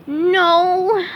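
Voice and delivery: high squeaky no; squeaky cartoon sponge; SpongeBob voice. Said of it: "said SpongeBob".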